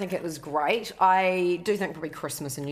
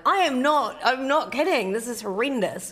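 insistent; 7 semitones higher than usual. Retold or imitated. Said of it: "I'm like".

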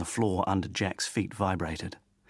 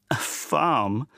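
a mild mocking guffaw, louder; 6 semitones higher, 4 dB louder than usual. A narrator's reading. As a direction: scoff at the idea with a laugh. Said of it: "his father guffawed".

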